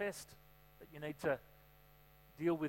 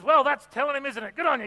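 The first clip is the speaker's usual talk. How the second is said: much higher, much louder amused cheer; delighted pastor; 8 semitones higher, 10 dB louder than usual. Retold or imitated. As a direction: cheer her on, hammed-up. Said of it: "the pastor grabs the mic and says".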